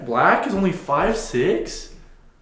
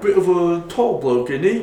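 loud hammed-up British bloke; mock British accent; 4 semitones higher, 5 dB louder than usual. Friends riffing on Brits, in a loud hammy British accent. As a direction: play a cheeky London bloke, up high.

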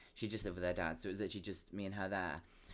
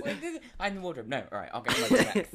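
laughing; 7 semitones higher, 9 dB louder, faster than usual. Real, not acted. Laughing joke interjection.

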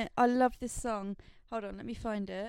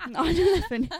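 laughing through the words; giggling it out; 6 semitones higher, 11 dB louder than usual. Real, not acted. Laughing while answering.

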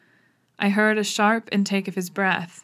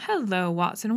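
usual speaking voice

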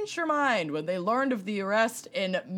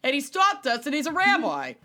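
higher-pitched mock New York voice; attempted New York accent; 5 semitones higher than usual. Driver telling of the rabbi who stopped him, attempted New York accent. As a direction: put on a broad, Boston-sounding New York accent. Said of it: "I can't do a New York accent".

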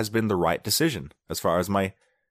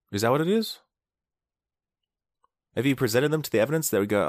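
ordinary talk, nothing marked

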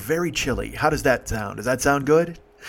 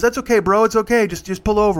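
put-on high, loud drunk-guy placating; mock insistent reassurance; imitated placating; 7 semitones higher, 4 dB louder than usual. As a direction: wave off the driver's worry, pitched up. Drunk passenger placating the driver.

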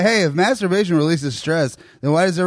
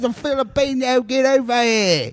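high, hammed-up bad Filipino accent; terrible fake accent; 10 semitones higher than usual. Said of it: "The worst fucking accent I could come up with".

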